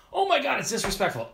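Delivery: high, huffy mock offense; mocking outrage. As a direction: sneer it like a pearl-clutcher. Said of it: "to be like".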